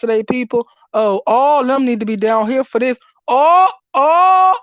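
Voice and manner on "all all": loud, high wail on each word; real pissed-off outburst. Real, not acted. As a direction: cry it out, overwhelmed with anger. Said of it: "I'm so pissed".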